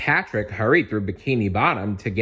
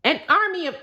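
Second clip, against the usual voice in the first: mistaken character voice; 4 dB louder, faster than usual. A narrator's reading. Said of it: "m-, m-, wait a minute".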